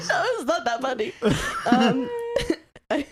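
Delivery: laughing helplessly; real giggling fit. Real, not acted. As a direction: protest while still cracking up. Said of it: "why I'm laughing so".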